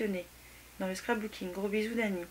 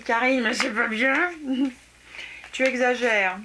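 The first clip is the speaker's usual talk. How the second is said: mock scolding; 2 semitones higher, 7 dB louder, slower than usual. Thanking a friend, mock scolding.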